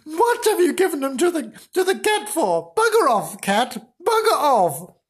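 high, fast, indignant telling-off; hammed-up cross wife; comic scolding. Dismayed wife scolds, shoos cat.

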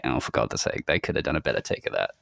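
low, soft aside of mock annoyance; muttered exasperation. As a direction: grumble it under your breath, fast at the end. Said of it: "head turn on and you're like".

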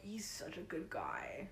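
soft hushed whisper. Retold or imitated. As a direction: whisper it secretly. Quoting Steven hushed.

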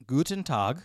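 loud mock German greeting; German accent. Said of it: "We'll handle both".

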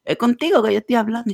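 mild retold shout; mock yell. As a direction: imitate someone yelling for your attention. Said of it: "él me grita y me dice".

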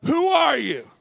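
bold shouted demand, high and loud; challenging shout. Retold or imitated. Joshua shouting at the armed stranger, loud challenge. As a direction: call out fearlessly to the armed stranger. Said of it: "he's basically like".

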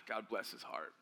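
soft, low breathy whisper; whispered aside. Real, not acted. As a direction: lean in and whisper it fondly. Whispered aside about the teacher.

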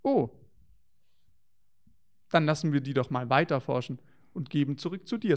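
ordinary talk

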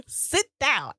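high-pitched, hammed-up order; exaggerated bossy outburst; mock stern command. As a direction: playfully order him back into place. Jokingly enacts ordering him to sit.

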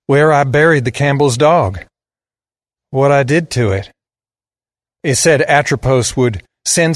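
put-on lighter southern drawl for the boy; boy's southern voice, explaining. A narrator's reading. Boy explains the letter on his pillow, in a southern drawl. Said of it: "He had a deep southern drawl".

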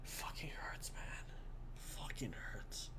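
slow, broken whisper; pained whisper. Real, not acted. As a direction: whisper it through the hurt. Mourning the victims, near tears.

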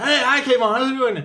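high-pitched mock caller greeting; imitating a caller. Imitating a confused caller greeting Kayvon, pitched high. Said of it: "They say".

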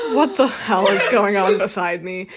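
slow, loud and laughing; laughing disbelief. Real, not acted. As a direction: crack up at what she sees. Reacting to friend's antics.

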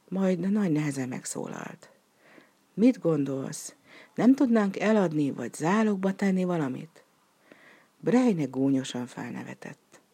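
ordinary delivery, nothing marked

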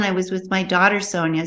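normal talking voice

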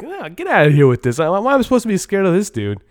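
high, quick, scornful brush-off at a weak villain; scoffing dismissal. Retold or imitated. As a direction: wave the guy off with a sneer.

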